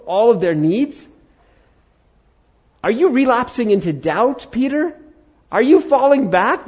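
high, loud, incredulous telling-off; scolding rebuke. Acted. Acted scolding, false Jesus berating Peter.

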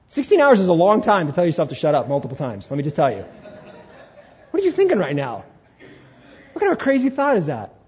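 ordinary delivery

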